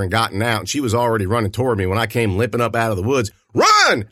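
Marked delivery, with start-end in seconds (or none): 3.5-4.1 high, loud urgent shout; panicked yell at Frankie. A narrator's reading. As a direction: warn her to run now. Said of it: "I yelled".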